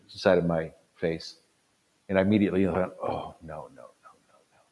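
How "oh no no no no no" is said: whispered dread. Retold or imitated. Dreading new nerve pain.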